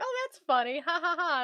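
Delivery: high, token "ha, ha, ha"; polite fake laugh. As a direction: humour him with a put-on laugh. Retold polite reaction to his joke.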